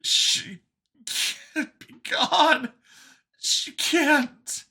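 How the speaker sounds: high, broken, tearful denial; crying. A narrator's reading. Vincent grieving his mother, crying. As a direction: choke it out slowly, breaking into high sobs. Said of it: "before he started to cry".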